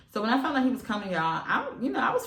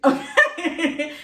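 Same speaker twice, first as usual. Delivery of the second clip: laughing; 10 semitones higher, 8 dB louder than usual. Real, not acted. Laughs at her own joke.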